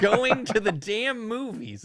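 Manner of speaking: hammed-up slow grumpy grandpa; mock grandpa yell. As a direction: imitate an exasperated old man, drawn out. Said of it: "he just yells at us".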